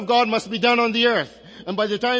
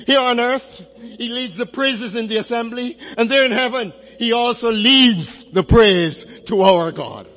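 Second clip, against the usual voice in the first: louder, rising to a ringing close; fervent preaching; 3 semitones higher, 6 dB louder than usual. Acted. Preacher exults to the congregation over Christ leading praise, loud and fervent. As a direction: lift it like a call from the pulpit.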